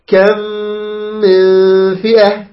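longer meem, shorter noon hum; correct measured ghunnah in كم من فئة. Shown as a demonstration. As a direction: recite slowly with controlled nasal holds. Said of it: "ونقرأ هكذا".

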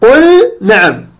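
slow قل with a lingering, distinct l before نعم; careful unmerged قل نعم; clear lam. Shown as a demonstration. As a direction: hold the l of قل, then release نعم. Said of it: "فاتبع على إظهار اللام".

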